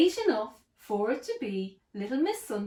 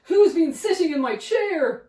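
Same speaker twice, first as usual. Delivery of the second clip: big bear demand; 6 semitones higher, 8 dB louder than usual. Acted.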